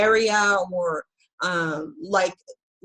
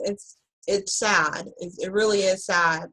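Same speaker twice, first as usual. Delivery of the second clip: usual speaking voice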